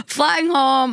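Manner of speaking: amused, laughing retelling. Retold or imitated. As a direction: laugh through the words.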